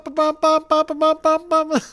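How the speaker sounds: rhythmic bum bum horn noises; imitated horn honks. Telling chat about the happy forklift guy, rhythmic horn bums. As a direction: tap out the horn's beat. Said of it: "driving the forklift, like".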